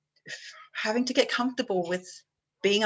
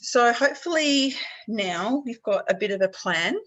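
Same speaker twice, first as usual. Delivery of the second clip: usual speaking voice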